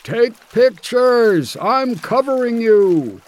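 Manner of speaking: high, drawn-out shout across a distance; booming yell. A narrator's reading. Ed yelling to Harley as the bear charges, booming shout. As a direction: call it out loud, stretching the words. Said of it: "yelling in his booming voice".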